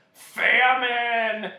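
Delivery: high, very loud drawn-out cry; anguished roar. A narrator's reading. Humbug realizing what he ate, anguished roar. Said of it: "roared the anguished humbug".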